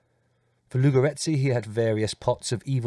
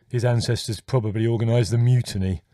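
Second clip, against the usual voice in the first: savouring a wicked suggestion, a little louder; with relish; 5 dB louder than usual. A narrator's reading. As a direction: relish every word of the jibe. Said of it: "suggested Leslie with relish".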